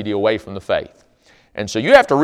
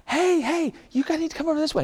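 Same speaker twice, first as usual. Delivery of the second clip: much higher, put-on girly voice; girls calling him away; 4 dB quieter than usual. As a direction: chirp it like a pretty college girl luring him off. Girls calling the young man away.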